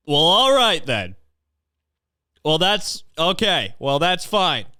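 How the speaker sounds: hammed-up high hurt acceptance; mock hurt reply. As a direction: play the dumped one pretending it's fine. Mock reply of the jilted person.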